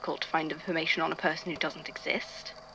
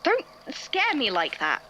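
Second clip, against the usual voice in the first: sudden high startled yelp, then a higher rattled question; startled outcry; 10 semitones higher than usual. Acted. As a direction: jump out of your skin at a friend still in the room. Startled by someone still in room.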